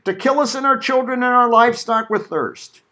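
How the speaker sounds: high-pitched accusing question, read in imitation; complaining. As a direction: voice the people's complaint to their leader. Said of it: "the people complained against Moses".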